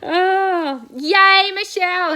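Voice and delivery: loud, high, delighted cheer; excited cheer. Real, not acted. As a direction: cheer her on loudly. Cheering a viewer's good ride.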